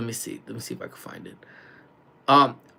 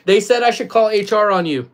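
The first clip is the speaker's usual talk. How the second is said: louder, higher playful threat; calling out teasingly; 6 semitones higher, 5 dB louder than usual. Real, not acted. Streamer relaying chat's HR threat to coworker, teasing call. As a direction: project the tease across the room.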